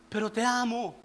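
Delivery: soft acted avowal; hushed declaration of love; tender confession. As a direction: say it quietly, as a humble plea. Acting penitent's answer.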